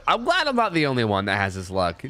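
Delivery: usual speaking voice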